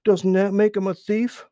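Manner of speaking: high, loud angry snap; sulky otter snapping back; snapped. A narrator's reading. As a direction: bite the words off in a temper. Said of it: "snapped Little Joe".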